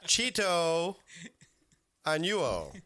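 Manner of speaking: high mock Italian-accented Spanish; thick Italian accent. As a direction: stretch broken Spanish with an Italian lilt. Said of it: "biggest, thickest Italian accent ever".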